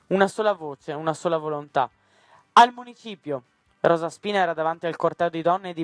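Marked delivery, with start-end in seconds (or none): 2.5-3.4 loud, high call to march; rally the crowd with a shout; rallying cry. A narrator's reading. Rosa Spina leading the women's march, crying out.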